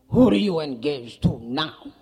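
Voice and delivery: loud, demanding question; lightly acted exasperated dad; wary father. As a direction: ask it loudly, as a suspicious papa. Wary Papa questions his daughter.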